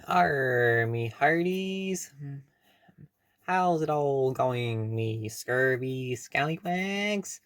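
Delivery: slow hammy pirate drawl. Acted. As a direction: growl it like a pirate captain. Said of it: "I like to talk like a pirate".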